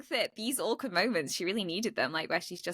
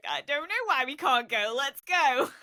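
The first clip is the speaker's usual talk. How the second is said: mock-pleading, pitched up; impatient family member; 6 semitones higher, 3 dB louder than usual. Family members pressing to go on the visit, impatient. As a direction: voice a clueless relative urging everyone along, higher.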